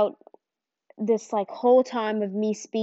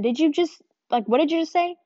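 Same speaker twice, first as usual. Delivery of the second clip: higher, startled and incredulous; can't believe what she just heard; asking him to repeat it in shock; disbelieving question; 6 semitones higher than usual. Retold or imitated.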